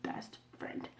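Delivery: dry whispered sarcasm; deadpan whispered scoff; sarcastic whisper. Real, not acted. Sarcastic repeat.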